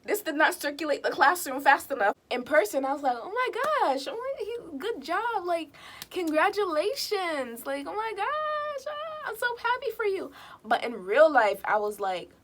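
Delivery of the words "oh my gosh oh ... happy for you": fake cheer. Fake happiness for the rival girl. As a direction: gush with obvious fakeness. Fake congratulations, high sweet.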